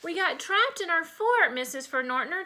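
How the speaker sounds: put-on high-pitched, excited kid's account; explaining child. A narrator's reading. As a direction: explain urgently to a friend's mom. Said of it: "Chipper explained".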